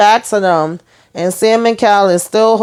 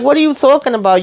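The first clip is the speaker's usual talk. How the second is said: high, quick, incredulous question; mock disbelief, rattled off quickly; retold indignation; 7 semitones higher, faster than usual. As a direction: imitate an indignant father, pitched up. Retold quarrel, father challenging mother.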